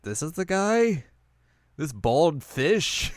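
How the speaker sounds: disbelief. Retold or imitated. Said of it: "Inuyasha and everyone's like".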